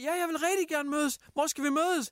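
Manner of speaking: høj, ivrig efterligning af en anden; hæv stemmen og spil overivrig og naiv; eager mock reply. Mocking the stranger's eager reply. (han spiller den fremmede, der ivrigt svarer kusinen, i høj overivrig tone)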